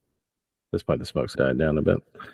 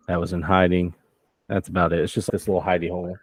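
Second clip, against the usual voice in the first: normal talking voice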